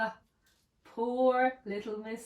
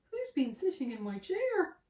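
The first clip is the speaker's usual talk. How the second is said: softer medium bear voice; 6 semitones higher, 5 dB quieter than usual. A narrator's reading. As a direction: ask it as the middle bear. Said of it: "said the medium bear".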